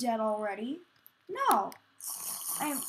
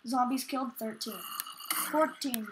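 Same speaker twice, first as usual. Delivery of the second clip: ordinary talk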